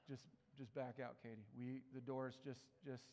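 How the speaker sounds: hushed retold urge to slip out; hushed urging. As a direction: murmur to your wife to back out. Urging wife out of scene.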